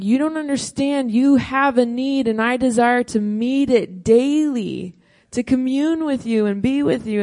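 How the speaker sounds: God's preaching voice. Acted. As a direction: proclaim it as God's own answer. God answering Israel, preached.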